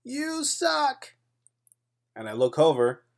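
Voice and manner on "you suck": high-pitched mock yell of a heckle; imitated heckler's yell. Brother heckling the rival captain from across a silent gym, high imitated yell. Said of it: "he decided to yell out loud".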